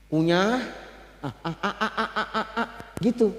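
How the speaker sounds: soft demo of chewing mouth sounds, ah ah ah; demonstrating chewing sounds. Shown as a demonstration. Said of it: "Kalau mau nyuapkan istrimu, gitu dulu". (peragaan mengunyah pelan)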